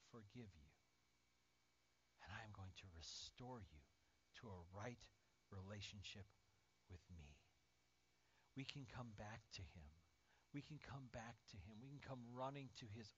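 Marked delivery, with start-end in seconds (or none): 0.1-7.4 low, soft, solemn promise; tender forgiveness. Acted. Jesus promising to restore fallen Peter, tender and hushed. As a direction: lower the voice into quiet reassurance.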